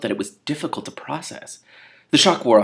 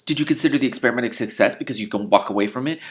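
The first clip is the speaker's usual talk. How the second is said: usual speaking voice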